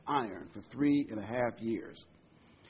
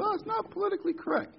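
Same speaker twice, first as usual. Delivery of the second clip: much higher, fast whiny mock voice; mocking whiny critic; faster than usual. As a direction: mimic a fussy objector, quick and high.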